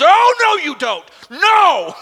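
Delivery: high, loud, barked refusal; outraged refusal. Retold or imitated. Paul catching the Galatians, loud outraged no. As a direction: slam the door on them. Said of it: "He just comes out like a dog".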